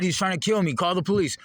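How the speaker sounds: mock panic, begging for help; frantic retold cry to a bystander; retold frantic yell. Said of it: "I said".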